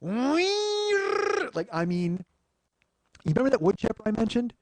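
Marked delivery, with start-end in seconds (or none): 0.0-1.7 very high, drawn-out screeching like; imitated screech. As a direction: mimic the engine shriek. Imitating car's screaming noise.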